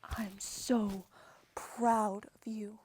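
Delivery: slow, hushed whisper; tender pride. Acted. Dad to daughter leaving for Harvard, tender whisper. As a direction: whisper it slowly and lovingly. Dad's tender farewell at dorm.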